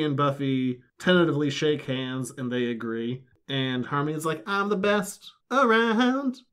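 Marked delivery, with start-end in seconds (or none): 4.5-6.4 mock-smug Harmony, higher and slow; Harmony boasting. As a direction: sing out her own praise. Harmony boasting in retold quote.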